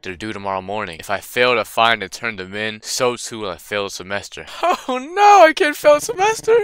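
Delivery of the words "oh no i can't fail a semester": high, hammed-up wail of dismay; mock panic. Gamer reacting to the game's threat, mock panic. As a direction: squeal in fake despair about the grade.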